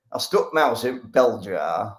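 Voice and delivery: broad Yorkshire accent, playful impression; Joseph Priestley. Chemist recounting his bell jar experiment, broad Yorkshire accent. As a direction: tell it as an old-time Yorkshireman. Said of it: "It's a decent impression".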